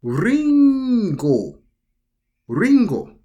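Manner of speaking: Ringo with a rolled, flapped R; Ringo said slowly and clearly, two even syllables; Japanese pronunciation. Shown as a demonstration. Teacher demonstrating Ringo to learners, clear and careful. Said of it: "we could say ringo".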